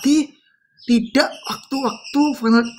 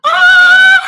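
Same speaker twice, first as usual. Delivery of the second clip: sudden shrill mock scream, much higher and louder; possessed scream imitation; 9 dB louder than usual. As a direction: shriek like a possessed woman. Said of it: "ini mbak-mbak kesurupan nih". (teriakan meniru mbak-mbak kesurupan)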